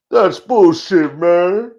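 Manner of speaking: high, loud, slow yell; shouted objection. Acted. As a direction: drag out a loud protest. Acted character quarrel.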